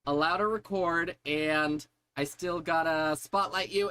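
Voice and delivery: normal talking voice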